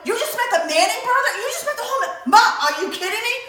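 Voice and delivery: high, loud, fast and laughing; can't believe your ears; laughing at mom's news in shock; laughing disbelief. Retold or imitated.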